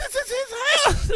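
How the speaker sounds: high, slow, hammed-up disbelief; mock shock. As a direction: stretch it out in fake amazement. Mock outraged realization.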